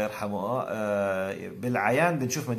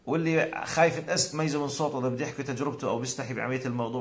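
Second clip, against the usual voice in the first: usual speaking voice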